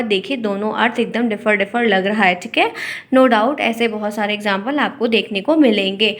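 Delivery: normal talking voice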